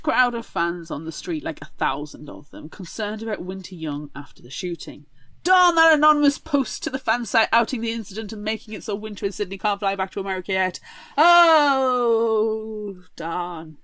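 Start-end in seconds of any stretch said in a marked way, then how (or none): 5.4-13.8 sarcastic. Acted. Reacting to the post that grounds Winter and Sydney, sarcastic. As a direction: overplay the fake annoyance. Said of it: "Did the sarcasm come through?".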